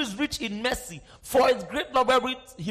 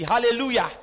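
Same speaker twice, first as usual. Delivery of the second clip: ordinary delivery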